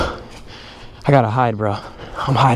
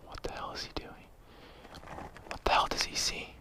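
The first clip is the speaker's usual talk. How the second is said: hushed, scared whisper; frightened whisper; 19 dB quieter than usual. Real, not acted. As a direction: whisper in hiding, terrified. Hiding close to gunman.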